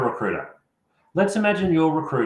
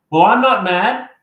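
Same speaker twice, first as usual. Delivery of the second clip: emphatic denial; 7 semitones higher, 9 dB louder than usual. Real, not acted. Answers imagined objection.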